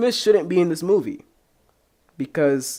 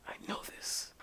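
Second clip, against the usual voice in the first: whispered to himself; whispered recall; 18 dB quieter than usual. Real, not acted. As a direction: breathe it as a private thought. Muttering while recalling.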